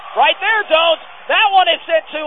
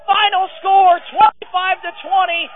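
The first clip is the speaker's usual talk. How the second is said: ecstatic call; 8 semitones higher than usual. Real, not acted. Announcer calling the winning point for the home team, ecstatic. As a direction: celebrate the win on air.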